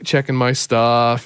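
usual speaking voice

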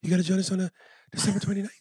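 high, loud would-be jazz announcer; Mark Wahlberg. Pretend. Co-host plugging a December show, high-voiced celebrity impression. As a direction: push the voice up high for an eager radio plug. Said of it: "if Mark Wahlberg wanted to be a jazz".

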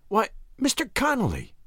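high, light woman's exclamation; taken aback, pitched high; exclaiming. A narrator's reading. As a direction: gasp out a protest in a high voice. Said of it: "exclaimed Nan".

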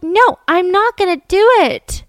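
high, loud, drawn-out acted protest; retold adamant refusal. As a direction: act out a teenager digging in against pressure. Daughter's retold refusal under pressure.

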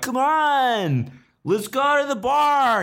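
high, drawn-out cheer imitating drunk pals; rowdy friends. Friends urging you to the bar, rowdy high-pitched imitation. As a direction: whoop it up as mock party friends.

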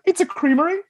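mocking a pretentious server correcting the customer, high and prim; snooty correction. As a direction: sneer it like a snob correcting you. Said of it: "He's like".

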